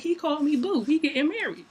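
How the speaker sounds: high, excited he called me boo; giddy announcement. Retold or imitated. Telling friends he called her boo, giddy.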